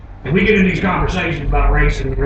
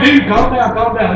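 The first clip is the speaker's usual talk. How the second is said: urgent calming; 7 semitones higher, 4 dB louder, slower than usual. Acted. Pacifying an angry friend, acted.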